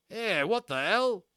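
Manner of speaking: high, slow, baffled outburst; a high, drawn-out startled yelp; startled skinhead. A narrator's reading. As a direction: caught completely off guard. Skinhead startled by tiny soldiers.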